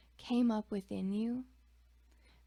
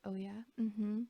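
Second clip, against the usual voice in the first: sarcastic. Acted.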